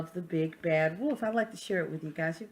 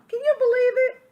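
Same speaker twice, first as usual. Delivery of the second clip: mock disbelief; 12 dB louder, faster than usual. Wolf marvels at straw house.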